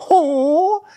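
very high drawn-out hoot; mock helpless "oh". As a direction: coo one long, high note. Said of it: "But she's just like".

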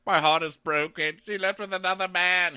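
high, loud comic wail; mock crying. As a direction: imitate a heartbroken man wailing. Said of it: "like, crying".

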